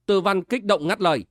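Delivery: plain reading voice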